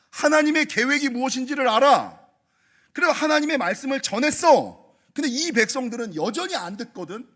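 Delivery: preacher building to a shout; full-force preaching. Acted. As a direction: drive the point home to the congregation.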